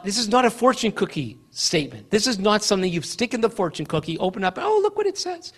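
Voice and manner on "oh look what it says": light, higher-pitched mock surprise; playful imitation of a pleased reader; mock delighted discovery. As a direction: act out reading a happy fortune, voice lifted. Acting out reading a fortune cookie.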